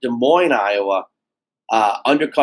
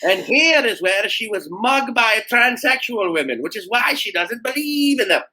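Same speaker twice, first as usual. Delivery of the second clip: put-on terrible Scottish accent; 9 semitones higher than usual. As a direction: play a tour guide with a bad Scottish accent. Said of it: "terrible Scottish accent".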